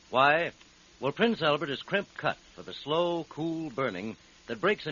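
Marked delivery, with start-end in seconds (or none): none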